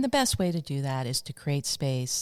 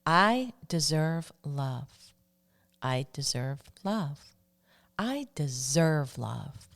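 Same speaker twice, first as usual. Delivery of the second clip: very slow, deliberate affirmation; slow recitation with space between repeats; slower than usual. Shown as a demonstration. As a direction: lead listeners in repeating it, word by word. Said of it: "Say that with me now".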